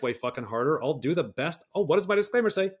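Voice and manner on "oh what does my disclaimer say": high, loud sarcastic aside; mock sarcastic aside. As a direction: toss it off mockingly. Mock self-quoting disclaimer.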